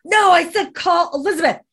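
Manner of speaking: high, loud bossy dad imitation; imitated screaming. Dad ordering daughter to call Elizabeth, mock screaming. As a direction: yell the order, starting loud and staying high. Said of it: "he's, like, screaming at her".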